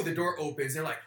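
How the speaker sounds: ordinary talk, nothing marked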